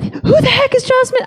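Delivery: very high-pitched frantic husband; David, hysterical. Retold or imitated. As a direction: squeal it in exaggerated panic. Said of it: "He's hysterical".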